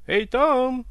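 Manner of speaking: high, loud call to servants. Acted. Butler summons the servants with a clap, calling out. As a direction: call sharply into the next room.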